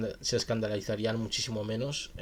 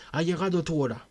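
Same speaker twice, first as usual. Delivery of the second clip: higher-pitched cry of confrontation; mild narrated cry; 6 semitones higher, 5 dB louder than usual. A narrator's reading. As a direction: call out the threat, pitch raised. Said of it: "asesino gritó". (grito narrado, contenido)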